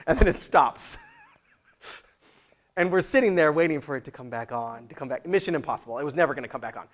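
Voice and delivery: ordinary delivery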